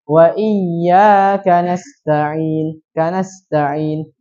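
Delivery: nasta'in with the sin cut short, no airflow; wrong sin without flow. Shown as a demonstration. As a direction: recite iyyaka slowly, sin not flowing. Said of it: "Tidak dialirkan". (sin pada nasta'in tidak dialirkan)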